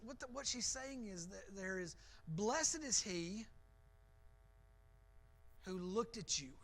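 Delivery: ordinary talk, nothing marked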